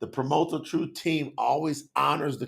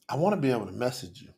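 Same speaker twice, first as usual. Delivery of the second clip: usual speaking voice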